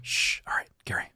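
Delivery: hushed whisper in a library. Acted. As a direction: whisper it so nobody hears. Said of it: "you're gonna have to whisper a little louder".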